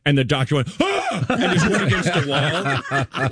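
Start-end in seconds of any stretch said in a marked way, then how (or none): 0.8-1.2 very high startled gasp; mock terrified gasp. Doctor watching baby flip over, high mock-terrified gasp. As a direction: imitate a doctor recoiling in terror. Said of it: "like, terrified".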